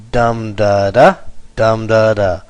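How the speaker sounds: heavy "dum", two lighter beats "da da"; dactylic stress. Shown as a demonstration. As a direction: punch "dum" first each time, then ease off. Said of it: "with the first one being long".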